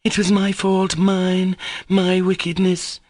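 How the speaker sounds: high, tearful wail of guilt; heart-rending cry. A narrator's reading. Grushenka at the captain's feet, wailing high. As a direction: plead through tears. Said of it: "she cried in a heart-rending voice".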